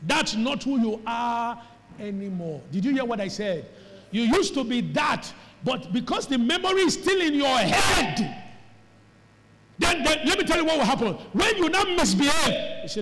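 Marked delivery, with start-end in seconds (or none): 4.1-12.8 loud, high-pitched pulpit shout; full-force preaching. Real, not acted. Preacher warning the congregation about misbehaving, shouted at full force. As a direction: drive it home to the congregation, voice raised high.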